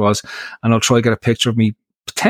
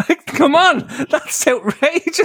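high, laughing disbelief; 12 semitones higher, slower than usual. Real, not acted. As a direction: squeal it through laughter. Cracking up over lyrics.

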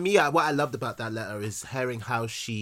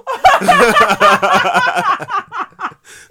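high, loud burst of real laughter; loud shrieking laugh; 12 dB louder than usual. Real, not acted. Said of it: "you do sound like that".

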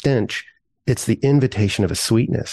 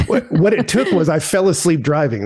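laughing through the words, a little higher; 5 semitones higher than usual. Real, not acted. Admitting he fell asleep driving, laughing. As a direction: chuckle as you confess it. Confessing an embarrassing story.

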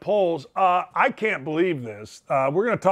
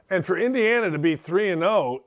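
ordinary talk, nothing marked

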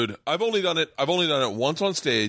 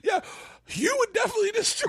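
much higher, teasing agreement; mock high voice. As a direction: agree in a silly squeaky voice.

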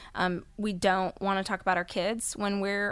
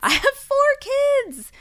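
much higher and louder on four; excited exclaim; 9 dB louder than usual. Real, not acted. Mother reacting to the host, an excited high exclaim. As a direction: burst out with the number.

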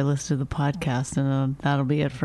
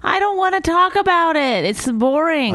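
louder, higher, fed up; 11 semitones higher, 3 dB louder than usual. Real, not acted. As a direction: raise the voice in protest. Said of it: "Talk up, please".

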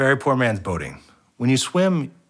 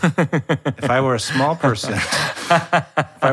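slow, laughing setup; laughing slowly; 3 semitones higher, much slower than usual. Real, not acted. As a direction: drag it out while laughing. Laughing, slowed comic setup.

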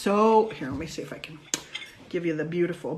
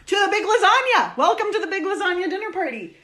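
high, loud showy welcome; excited announcement; 10 semitones higher, 4 dB louder, faster than usual. Real, not acted. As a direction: announce it like a show host. Host announcing the show title.

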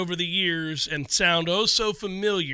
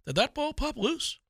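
higher, soft hopeful question; imitated hopefulness; 6 semitones higher, 6 dB quieter than usual. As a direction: imitate a hopeful guy asking softly. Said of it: "That hopefulness in a guy's voice".

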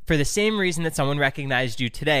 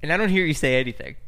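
ordinary talk, nothing marked

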